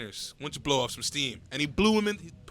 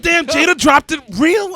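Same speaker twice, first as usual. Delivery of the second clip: loud and higher, impressed outburst; excited exclamation; 9 semitones higher, 11 dB louder, slower than usual. Retold or imitated. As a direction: blurt it out, amazed. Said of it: "That's what I was like".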